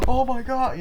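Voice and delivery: loud, very high mock shock; shocked gasp. Girl seeing the gun pulled, high shocked cry. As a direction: gasp and cry out in acted horror.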